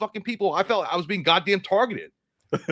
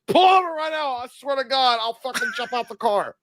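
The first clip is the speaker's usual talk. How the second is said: put-on frantic drunk yell; 9 semitones higher than usual. Drunk passenger ordering the driver to stop, frantic high yell. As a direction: yell it high like you're about to be sick.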